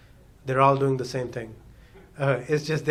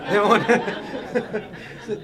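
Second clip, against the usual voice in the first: laughing; 7 semitones higher, 5 dB louder than usual. Real, not acted. Laughing about untranslatable jokes.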